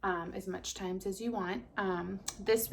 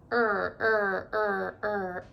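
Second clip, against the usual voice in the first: paddling sound; 5 dB louder, much slower than usual. Acted. Rowing sound in the story.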